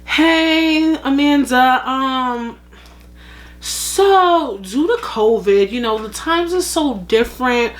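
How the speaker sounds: butter her up before firing her; mock corporate boss. Boss on Zoom easing Amanda out, sugary and fake.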